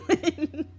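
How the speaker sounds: quick burst of laughter; short laugh. Real, not acted. Laughing at the imitation.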